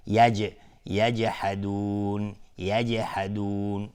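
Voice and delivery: slow یجحدون with a small rebound after ج; qalqala on jim. Shown as a demonstration. Teacher giving a mid-word example, bounced ج. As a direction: bounce the ج and stretch the end of یجحدون.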